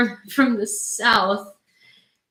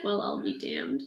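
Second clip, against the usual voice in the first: quiet prompt; 10 dB quieter, slower than usual. Shown as a demonstration. Feeds the Southern line softly.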